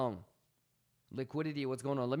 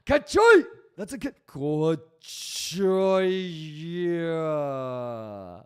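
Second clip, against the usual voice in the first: much higher, much louder yelp of Kachoy; catchphrase yell; 4 semitones higher, 11 dB louder, much slower than usual. Real, not acted. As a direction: belt out the catchphrase in triumph. Catchphrase yelled at chat.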